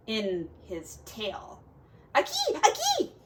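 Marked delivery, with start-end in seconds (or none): 2.1-3.1 squeaky high cry of pain with a laugh; high yelp. Acted. Stung Turtle yelping at the Yellow Jackets, high and squeaky.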